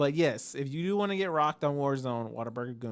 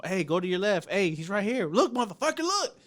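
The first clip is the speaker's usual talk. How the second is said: rising, louder urgent game callouts; 6 semitones higher, 4 dB louder than usual. Retold or imitated. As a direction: bark directions at a teammate, building to a yell. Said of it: "I'm doing something like that".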